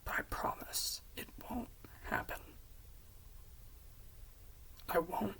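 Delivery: hushed, halting promise; soft, effortful vow; struggling to say it. Retold or imitated. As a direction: get it out softly between long pauses. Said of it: "having a hard time saying this".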